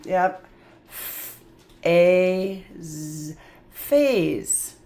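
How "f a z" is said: F, A, Z as three separate sounds: a whispered f, a long a, a z; sounding out. Shown as a demonstration. Teacher sounding out phase for kids: f, long a, z. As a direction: sound out each letter sound slowly. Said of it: "this S is gonna do that sneaky Z sound".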